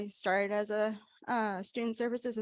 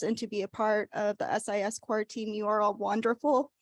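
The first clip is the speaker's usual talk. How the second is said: ordinary talk, nothing marked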